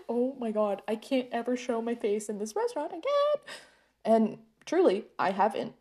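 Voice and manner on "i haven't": usual speaking voice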